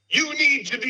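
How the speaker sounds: high, loud retold yell; imitated yell. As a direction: belt it out like someone demanding their seat back. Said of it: "one of my favorite yells I've ever heard".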